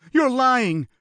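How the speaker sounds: high, loud frightened cry; scared man accusing the dark; panicked denial. A narrator's reading. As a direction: yelp it in terror.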